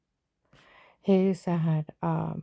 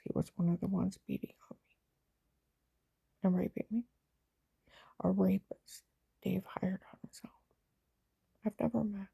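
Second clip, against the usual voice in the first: hushed telling; 2 semitones higher, 6 dB quieter than usual. Real, not acted.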